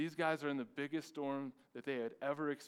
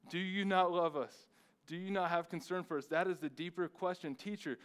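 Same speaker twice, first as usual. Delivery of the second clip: loud, pleading voice of panicked disciples; desperate disciples' cry; 4 semitones higher, 6 dB louder than usual. Acted. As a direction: cry out as if the boat is sinking. Disciples crying out in storm.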